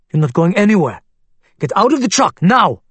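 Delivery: high, loud guard's bark; guard shouting at Kayla through the window; shouted order. Acted. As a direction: yell the command at the van.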